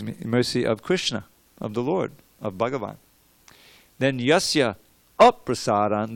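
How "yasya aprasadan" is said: "aprasadan" with the opening A hit loud; stress the A. Shown as a demonstration. Teacher stressing the A that flips the meaning. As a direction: punch the A of "aprasadan". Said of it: "that A makes all the difference".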